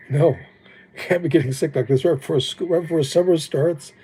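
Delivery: normal talking voice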